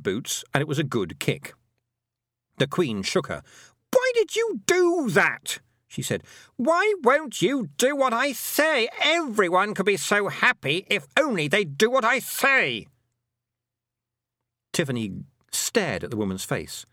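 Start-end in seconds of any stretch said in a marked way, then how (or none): 3.9-5.6 high, loud, drawn-out shriek of outrage; angry outburst. A narrator's reading. Queen rages after being kicked. 6.6-12.9 high, wailing tantrum; raging Queen. A narrator's reading. Queen rages at disobedient girl.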